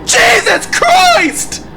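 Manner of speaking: long, high, loud, slow scream of terror; terrified scream. Real, not acted. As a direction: scream it out in real shock. Jump scare in horror game.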